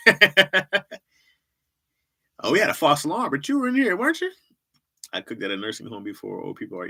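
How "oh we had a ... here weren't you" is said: rising surprised mortician voice; a mortician lightly voiced, surprised to see her again; mock mortician. Pulling up to a funeral, mortician greets the girlfriend with a rising surprised voice.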